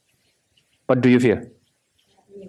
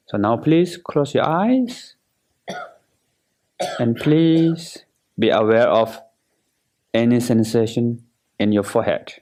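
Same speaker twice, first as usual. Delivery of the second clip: guided meditation. Acted. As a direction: guide them through relaxing, unhurried and measured.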